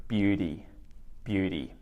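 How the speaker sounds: "beauty" said BEW-dee, T softened to D; beauty with a flapped d; "beauty" quiet, BYOO-dee. Shown as a demonstration. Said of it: "changed that T into a D sound".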